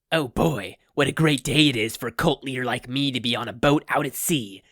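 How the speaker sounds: hammy cheerful villain voice; cheery villain. A narrator's reading. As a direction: gloat happily to the sea. Cult leader cheerful on boat.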